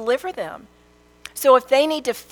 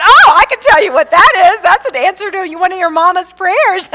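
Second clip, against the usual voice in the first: much higher, loud and delighted; gleeful reply; 10 semitones higher, 14 dB louder than usual. Retold or imitated. Mother telling her son God is chasing him, loud glee. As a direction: crow it out, thrilled the prayer worked. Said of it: "And I said".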